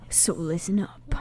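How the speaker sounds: soft conspiratorial whisper. Real, not acted. Quieting listeners before the synopsis, whispered. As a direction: hush the room before the story.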